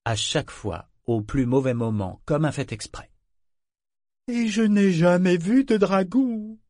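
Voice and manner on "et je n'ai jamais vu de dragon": pitched way up and louder, voice cracking; voice cracking high. Acted. Figurehead insisting he never saw a dragon, voice cracking high. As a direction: let the voice crack and climb high as he denies it. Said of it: "sa voix devint plus aiguë et se fêla".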